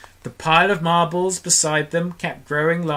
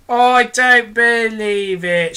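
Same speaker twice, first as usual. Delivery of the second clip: loud, higher, slow disbelief; shouting; 8 semitones higher, 7 dB louder, much slower than usual. Acted. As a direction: refuse to believe it at full volume. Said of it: "shouted the centipede, raising his voice".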